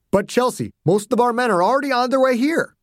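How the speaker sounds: high, urgent protest; general objecting. Acted. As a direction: plead with a child in command. Voices General Ogden.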